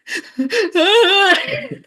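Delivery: high laugh breaking into a sob; laughing into tears. Real, not acted. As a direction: laugh that tips into crying. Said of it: "burst into tears".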